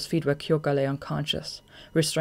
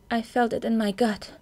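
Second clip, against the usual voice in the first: put-on higher, gentle girlish voice; 7 semitones higher than usual. Kyoka speaks, narrated dialogue.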